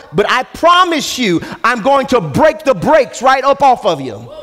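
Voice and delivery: high, driving sermon delivery; full-force preaching. Real, not acted. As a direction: preach it with rising intensity, pitch held high. Preacher builds to a defiant climax.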